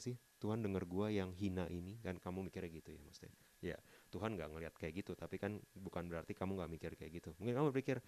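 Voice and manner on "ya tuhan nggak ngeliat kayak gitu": normal talking voice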